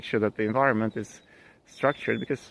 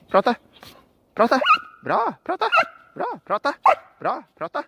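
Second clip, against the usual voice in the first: much higher, bright coaxing praise for the dog; high-pitched dog praise; 12 semitones higher, 4 dB louder than usual. Real, not acted. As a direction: cheer the dog on in a high sing-song.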